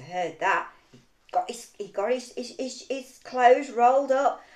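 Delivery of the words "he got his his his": ordinary delivery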